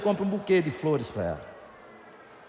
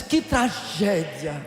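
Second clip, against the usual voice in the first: sarcastic; 4 semitones lower, much slower than usual. Retold or imitated. Husband mocking wife's broken nail.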